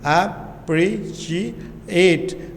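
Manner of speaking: अप्रिशिएट slowly split into its syllables. Shown as a demonstration. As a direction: say अप्रिशिएट in separate, deliberate pieces. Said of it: "आराम से आहिस्ता से अदा करें". (अप्रिशिएट का हर syllable अलग-अलग, आहिस्ता से)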